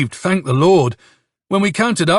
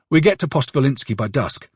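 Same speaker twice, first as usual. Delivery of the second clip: usual speaking voice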